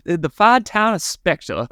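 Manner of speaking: Southern accent, playing it up. Shown as a demonstration. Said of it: "that southern drip in there".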